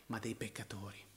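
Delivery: breathy whisper, almost no voice. Real, not acted. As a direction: lean in and whisper the last words to the listeners. Whispered end of quote.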